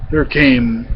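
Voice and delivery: ordinary delivery, nothing marked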